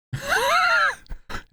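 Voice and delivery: high shriek of laughter. Real, not acted. Laughing at the Australian community.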